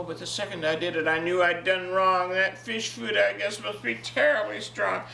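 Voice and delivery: ordinary delivery, nothing marked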